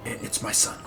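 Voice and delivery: soft, voiceless admission; ashamed whisper. Acted. Priest confessing about his son, whispering. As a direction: let it slip out quietly.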